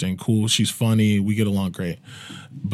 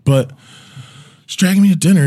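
usual speaking voice

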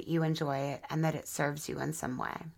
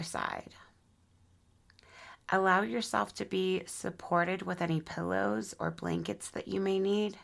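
unhurried, soothing guide through breath and body; guided meditation. Acted. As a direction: ease them gently into relaxation, phrase by phrase.

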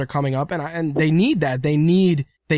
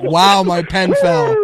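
slow, loud fake surprise; retelling the zipper trick, hammed up; mock innocent; 2 semitones higher, 6 dB louder, slower than usual. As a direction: drag it out with a wink. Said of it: "repeating the pen line".

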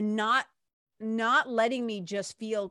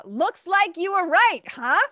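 much higher, louder, slow told-you-so line; mock told-you-so; 10 semitones higher, 11 dB louder than usual. Opening the story to the viewers, loud high teasing quip. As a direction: belt it out as a teasing punchline.

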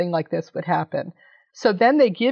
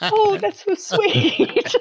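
much higher, slow, sugary mock reply; imitating a sweet reply; 11 semitones higher, much slower than usual. Nicole Byer answering the winner's shout-out, high and slow. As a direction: coo it back slowly and sweetly. And she goes.

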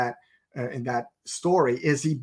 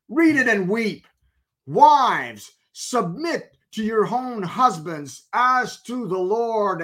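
higher, louder, slow triumphant reading; triumphant gloat; 8 semitones higher, 3 dB louder, slower than usual. Retold or imitated. Husband shoving the verse at the pastor, gloating. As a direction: rub it in his face.

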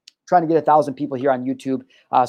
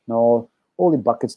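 put-on a light, half-hearted Minnesota accent; 3 semitones lower than usual. Host mocking a Minnesota accent, lightly. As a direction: toss off a mild Minnesota accent as a joke. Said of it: "I'm terrible at accents".

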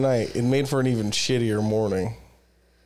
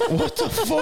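laughing, much higher what the fuck; shocked laugh. Retold or imitated. Retelling waking up, laughing in disbelief. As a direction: burst into a laughing squeal of disbelief.

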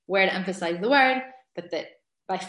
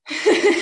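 a burst of happy laughter; excited laugh; 9 semitones higher, 7 dB louder than usual. Real, not acted. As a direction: laugh out loud with joy. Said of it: "I get so excited".

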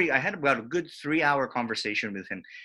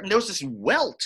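ordinary talk

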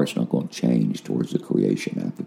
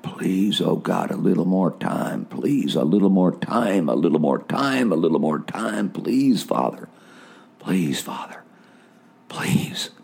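higher, begging, rising to a desperate peak then sinking to a whisper; pleading cry; 6 semitones higher than usual. Retold or imitated. Man on his knees begs God for more time, desperate pleading cry. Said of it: "And I'm crying out".

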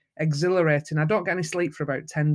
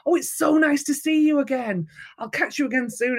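friendly Bradley; 9 semitones higher than usual. Retold or imitated. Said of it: "And he's like".

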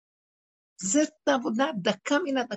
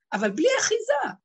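crying out; 11 semitones higher, 4 dB louder than usual. Real, not acted. Speaker cries out in distress.

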